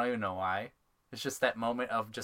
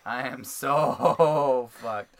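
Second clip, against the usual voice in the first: laughing; 9 dB louder, much slower than usual. Real, not acted. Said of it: "laughs mid-phrase".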